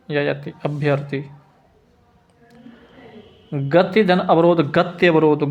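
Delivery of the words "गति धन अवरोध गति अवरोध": usual speaking voice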